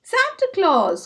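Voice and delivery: high, bright announcement of the news; excited exclamation. Acted. Hare telling Grey Rabbit who came, excited. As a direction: blurt out the name with delight. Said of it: "exclaimed Hare".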